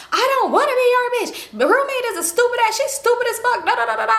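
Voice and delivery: high-pitched mock catfight retort; arguing. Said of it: "they just arguing at this point".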